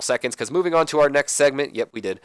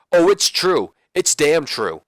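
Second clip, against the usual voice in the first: hyped catchphrase; 5 semitones higher, 5 dB louder, slower than usual. Retold or imitated. Kurt Angle catchphrase.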